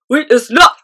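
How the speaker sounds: loud, high mob shout; giants shouting. A narrator's reading. Other giants answering the Flesh Lump Eater, loud shout. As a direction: roar it back as a crowd of giants. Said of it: "shouted the other giants".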